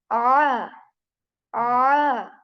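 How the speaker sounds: ah squeezed from mid-throat; choked ah. Shown as a demonstration. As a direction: push the tongue back and choke out ah. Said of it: "up in the throat, middle portion".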